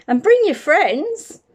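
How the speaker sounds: put-on a girl begging her friend to return; little girl voice. Jenny begs the bear.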